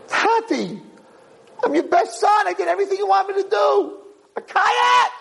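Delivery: a wronged son shouting in disbelief; indignant protest. Acted. Youngest son protests to father.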